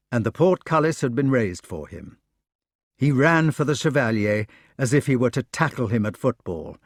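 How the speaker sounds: ordinary delivery, nothing marked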